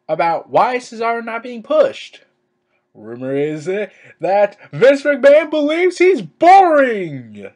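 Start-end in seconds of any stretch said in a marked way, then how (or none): 4.2-7.5 loud, rising yell of disbelief; incredulous shout. Real, not acted. Vlogger ranting over a wrestling rumor, incredulous shout. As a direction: shout it out, drawn out and louder. Exasperated rant at the news.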